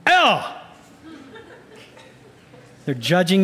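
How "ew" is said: high, louder, disgusted ew. Retold or imitated.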